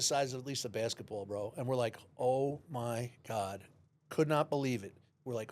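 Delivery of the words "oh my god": slow, drawn-out disbelief; awestruck, very slow; stunned. Retold or imitated. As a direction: stretch each word out in retold amazement. Stunned reaction to seeing tiger's head.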